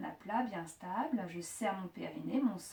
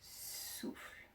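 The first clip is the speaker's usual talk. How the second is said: very soft, voiceless exhale on the word; breathed-out cue; 14 dB quieter than usual. Shown as a demonstration. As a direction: breathe the word out slowly and softly. (soufflé tout bas, en expirant)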